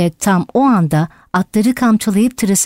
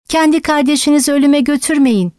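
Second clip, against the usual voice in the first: pleading shout; 6 semitones higher than usual. A narrator's reading. Animals begging horses to spare their brother, pleading cry. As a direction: implore them with the pitch held up. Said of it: "diye bağırıyorlardı".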